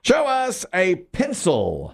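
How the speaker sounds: high, drawn-out host's call; game-show reveal call. Real, not acted. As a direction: sweep from high down, very slowly. Host calls for the board reveal.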